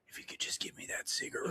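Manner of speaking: desperate breathy whisper; pleading whisper. Retold or imitated. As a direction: beg for it in a whisper. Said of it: "getting a cigarette".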